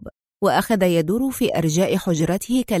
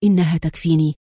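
stern murmur; 4 semitones lower than usual. A narrator's reading. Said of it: "غمغم ياكوف في صرامة".

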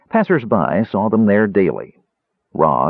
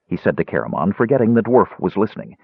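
ordinary delivery

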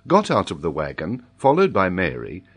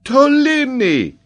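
very high, loud, stretched-out cry; amazed, drawn-out outburst; exclaiming; 8 dB louder, slower than usual. A narrator's reading. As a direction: exclaim the name slowly, soaring high. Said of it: "exclaimed Paganel".

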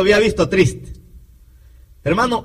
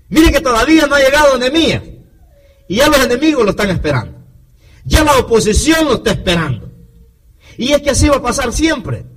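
fast, louder, higher preaching push; fervent preaching; 5 semitones higher, 3 dB louder, faster than usual. Acted. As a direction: drive the warning home quickly and loudly. Preacher presses point, fast and loud.

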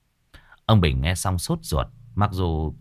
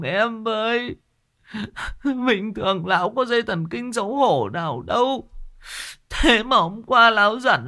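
wailing and crying. Acted. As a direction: beg the chief through tears. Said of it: "Con mụ này nó khóc". (giọng mếu máo khóc lóc van xin)

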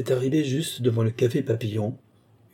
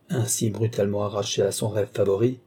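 usual speaking voice

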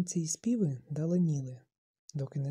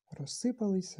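ordinary delivery

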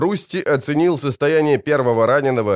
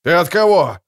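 louder commanding call; shouting; 4 semitones higher, 4 dB louder than usual. A narrator's reading. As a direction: call out over the noise. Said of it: "крикнул Ян Наталис".

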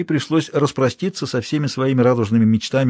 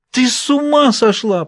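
horrified outcry; 12 semitones higher, 3 dB louder, slower than usual. A narrator's reading. Prince horrified by poison plan.

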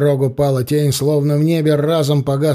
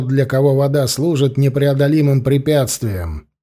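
usual speaking voice

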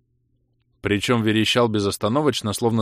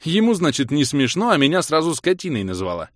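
raised, louder grievance; indignant complaint; 7 semitones higher, 4 dB louder than usual. Acted. Hero protesting being called a brute, indignant. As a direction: complain at the unfairness.

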